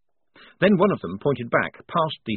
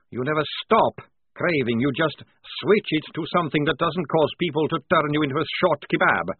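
plain reading voice

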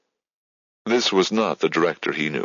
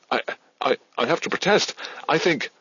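much higher, halting protest; stammering protest; 10 semitones higher than usual. A narrator's reading. Midge stammers a protest to the director, much higher. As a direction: stumble then push out the objection. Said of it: "she stammered".

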